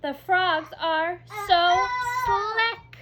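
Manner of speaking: high, loud, slow and sing-song; exclamation read with delight; with excitement. Shown as a demonstration. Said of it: "We say that with excitement".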